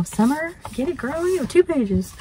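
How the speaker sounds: high, bright cheering for a friend; excited cheer. Real, not acted. Vlogger cheering on her pen pal Summer, excited.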